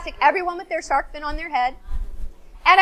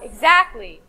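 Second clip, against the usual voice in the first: usual speaking voice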